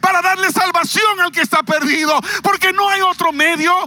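preaching at full force. Acted. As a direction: preach it out, building to shouts. (predicación a todo pulmón, cada vez más alta)

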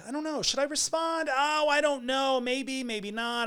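very high-pitched, sing-song indecision; mock dithering. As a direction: waver aloud in a squeaky high voice. Voices own dithering over replying.

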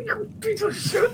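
very high restrained snarl; furious but keeping the voice down; hushed angry rogue. Acted. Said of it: "aware of the volume of his voice".

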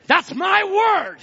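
high, loud, drawn-out climax; emphatic preaching shout. Real, not acted. Preacher claiming the prophet's word before church, emphatic shout. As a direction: cap the list with a slow shout.

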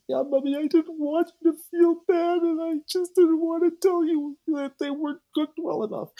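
put-on very high, loud childlike voice, tearful; crying little girl. Daughter confessing to her mother, high tearful child voice. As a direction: squeak it out like a small girl owning up in tears. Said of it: "her daughter starts to cry and said".

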